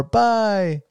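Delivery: put-on high, bright bye-bye to a baby; dad singing bye-bye to his baby girl; sing-song baby talk. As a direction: coo it to your daughter like a dad.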